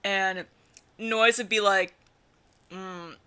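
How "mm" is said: mumbly, low, very soft and slow. Acted. Showing Noise's voice to co-reader, overdone low mumble. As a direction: mumble it low under the breath.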